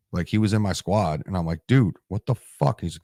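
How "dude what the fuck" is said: a slow, raised, incredulous "what the"; retold outraged disbelief. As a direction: replay the moment he got hit. Retold shock at being shot.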